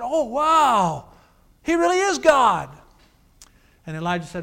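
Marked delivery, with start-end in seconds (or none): 0.0-2.7 higher and louder cry of amazement; awed exclamation. Retold or imitated. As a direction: gasp out the wonder of the crowd. Crowd amazed at fire from heaven.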